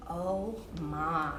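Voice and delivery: ordinary delivery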